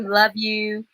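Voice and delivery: ordinary talk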